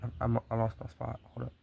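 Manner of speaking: plain reading voice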